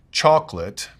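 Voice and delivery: CHOCOLATE alone, stressed on its first syllable; word stress. Shown as a demonstration. Teacher citing an ice cream flavor, CHOCOLATE with full first stress. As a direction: hit the start of CHOCOLATE. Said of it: "we'll change that stress".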